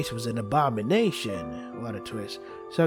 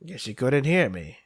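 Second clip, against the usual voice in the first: ordinary talk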